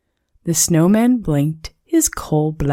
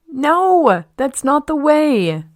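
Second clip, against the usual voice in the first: put-on yelling children; 10 semitones higher than usual. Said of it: "The children yelled".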